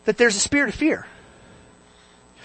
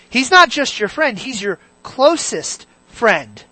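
normal talking voice

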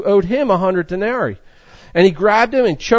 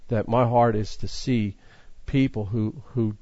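much softer, lower confession; hushed heartfelt admission; quiet sincere; 8 semitones lower, 8 dB quieter than usual. Real, not acted. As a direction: speak it humbly, almost to yourself.

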